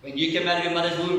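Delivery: ordinary talk, nothing marked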